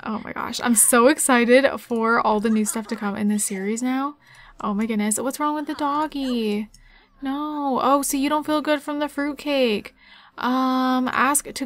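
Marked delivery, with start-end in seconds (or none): none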